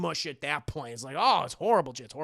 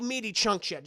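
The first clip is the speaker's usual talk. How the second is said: normal talking voice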